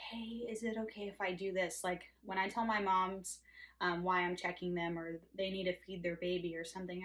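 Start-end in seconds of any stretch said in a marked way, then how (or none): 0.1-1.8 soft, tentative request for permission; hesitant, quiet permission-seeking; timid asking. Retold or imitated. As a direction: ask meekly, unsure of yourself. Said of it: "you can't just say".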